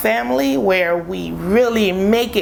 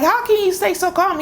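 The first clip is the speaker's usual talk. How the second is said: frantic question; 10 semitones higher than usual. Retold or imitated. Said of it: "And I'm like".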